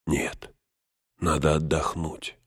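very soft, drained insistence; exhausted refusal. A narrator's reading. Frodo, frozen by tiredness, insists on rest, softly drained. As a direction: breathe it out with no strength left. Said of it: "Неимоверная усталость сковала его".

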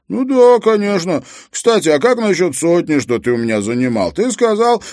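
high, loud cranky phone voice; grumpy old friend. A narrator's reading. Treska bringing up an unpaid loan to Harry, querulous.

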